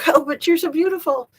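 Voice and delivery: cooing high and bright with affection; high doting coo to a loved one; high sweet coaxing. Real, not acted. Coaxing her family member.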